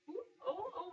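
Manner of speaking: high startled ooh; startled cry. Real, not acted. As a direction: yelp at the spill. Dough collapses on the table.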